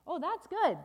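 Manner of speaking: high, loud burst of delight; delighted refrain. Acted. Storyteller reacts to the balloon ride, loudly delighted. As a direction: cheer the good news.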